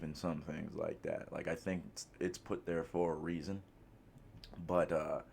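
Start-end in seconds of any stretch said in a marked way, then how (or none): 4.7-5.2 slurping a drink mid-sentence. Real, not acted. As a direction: sip noisily into the mic. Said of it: "I gotta stop slurping into the fucking mic".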